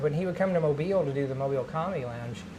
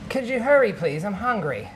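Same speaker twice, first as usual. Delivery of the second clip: lightly voiced badger, pitched up at the start; impatient badger; 5 semitones higher, 4 dB louder than usual. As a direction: pipe up like a pushy little animal. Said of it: "the badger is saying".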